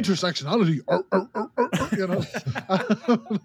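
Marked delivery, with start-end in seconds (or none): 0.9-1.9 high mock caveman grunts; imitated sitcom grunting; Tim Allen grunt. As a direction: bark out a string of comic grunts.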